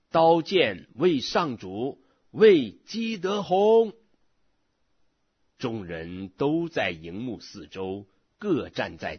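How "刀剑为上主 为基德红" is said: drawn-out rallying shout, rising high on the name; battle cry. A narrator's reading. Three hundred men cry out around the enemy camp, shouting a war cry. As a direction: cry it out high and long, louder at the end. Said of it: "并喊叫说".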